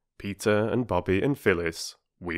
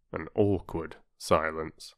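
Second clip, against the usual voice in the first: normal talking voice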